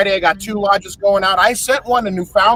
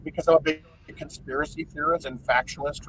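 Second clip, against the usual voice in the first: ordinary delivery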